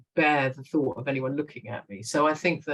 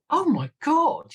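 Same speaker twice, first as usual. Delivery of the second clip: slow, much higher, louder gasp of delight; excited; 12 semitones higher, 6 dB louder, slower than usual. Retold or imitated. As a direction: stretch it out, thrilled. Producers reacting to found script.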